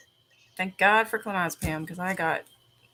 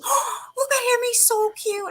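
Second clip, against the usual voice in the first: much higher squealing gush; squealing cute voice; 4 dB louder than usual. Retold or imitated. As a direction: coo over the cute chipmunk. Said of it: "he goes".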